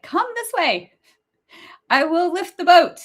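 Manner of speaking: high, grand lighthouse calling out to boats. Pretend. Pretending to be the lighthouse, calling to a boat in a high grand voice. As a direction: play the lighthouse guiding a boat home. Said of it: "I wanna be like".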